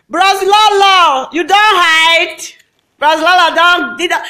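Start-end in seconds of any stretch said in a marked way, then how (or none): 0.1-2.4 stretched-out taunting shout; mock calling out. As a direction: call out the name like a teasing hail. Hailing Bros Lolo mockingly.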